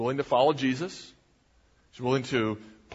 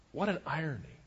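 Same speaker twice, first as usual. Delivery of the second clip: usual speaking voice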